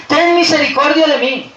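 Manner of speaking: high, forceful cry for mercy. Acted. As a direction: shout your plea over the crowd. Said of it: "Y él gritaba más". (grito suplicante, alto y agudo)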